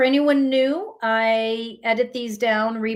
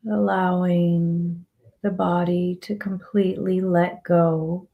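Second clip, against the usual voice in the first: slow, calm meditation voice with long pauses; guided meditation; 3 semitones lower, slower than usual. Acted. Guiding listeners to relax the body and let go, slow meditative calm. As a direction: ease them into relaxation, phrase by unhurried phrase.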